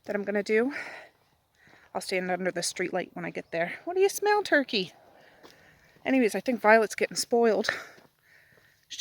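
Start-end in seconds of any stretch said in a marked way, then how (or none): 3.9-4.9 high, sing-song talk to the dog; pet talk voice. Real, not acted. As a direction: coo at a curious puppy.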